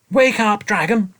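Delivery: sharp, loud wake-up call; sharp command. A narrator's reading. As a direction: snap the dragon awake. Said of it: "he said sharply".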